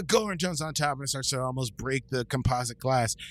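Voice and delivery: ordinary delivery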